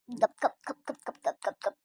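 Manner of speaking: gulping sounds. Acted. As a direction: gulp the water down noisily. Drinking water in big gulps. (गटगट पानी पीने की आवाज़)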